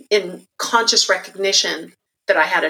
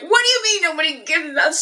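much higher, indignant outburst; retold outrage; 10 semitones higher than usual. Recalling her younger self's indignant reply, pitched high. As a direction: squeak it out in stunned outrage. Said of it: "I was pissed".

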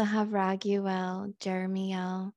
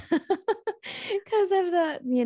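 laughing; 10 semitones higher, 4 dB louder, much slower than usual. Real, not acted. Laughs while explaining recording.

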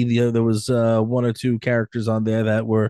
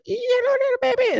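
tiny squeaking critter, far higher; squeaky hamster voice. Pretend. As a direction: squeak it like a hamster poking its head out. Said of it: "Did he make that noise?".

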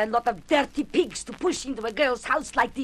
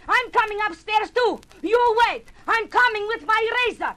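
furious threat; 9 semitones higher than usual. Acted. Josephine threatens the men upstairs with her razor, yelling. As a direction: threaten them at full voice.

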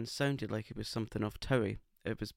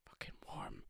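muttered curse under breath; whispered swear; 15 dB quieter than usual. Real, not acted.

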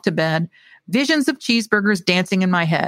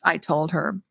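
plain reading voice

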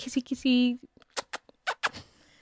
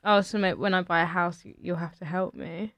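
low, softly retold chat-up; understated, low-key flirting; awkward flirting; 4 semitones lower, 9 dB quieter than usual. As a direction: flirt half-heartedly, fading very quiet. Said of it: "My flirting was".

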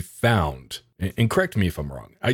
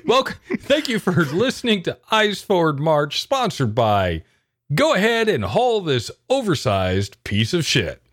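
upbeat podcast announcer, pitched up; 8 semitones higher than usual. Pretend. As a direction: read it like a cheesy sponsor spot. Mock podcast sponsor read.

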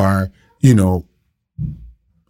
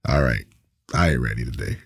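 reluctant mumble; 12 semitones lower, 8 dB quieter, faster than usual. Retold or imitated. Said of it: "And I'm like".

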